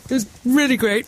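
put-on plummy posh English accent. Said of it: "that horrible posh voice".

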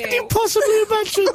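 high mock-shocked squeal; incredulous shriek.

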